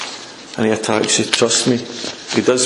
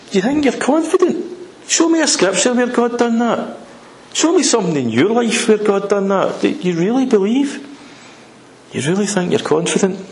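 high-pitched, scornful demand; taunting challenge; 7 semitones higher than usual. Acted. Devil with his spanner to a believer, taunting challenge. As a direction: goad them, pitched well up.

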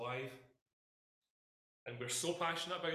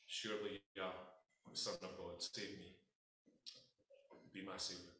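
hushed closing prayer; 5 semitones lower, 6 dB quieter than usual. Acted. Closing prayer fading low.

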